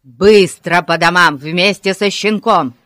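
loud policeman's command; angry order. A narrator's reading. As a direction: shout them off the scene. Полицейский гонит детей. (громкий сердитый приказ)

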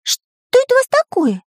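very high-pitched cartoon astonishment; surprised question. A narrator's reading. Pencil spots the egg, high surprised squeak. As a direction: ask amazed in a tiny high voice. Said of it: "удивился Карандаш".

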